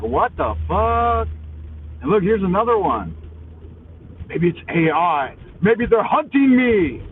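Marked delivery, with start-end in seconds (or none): 0.0-1.3 slow, drawn-out mock disbelief; retold disbelief. Retelling his reaction to the driver, slow and stunned. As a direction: stretch it out, half-dazed. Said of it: "And I was like".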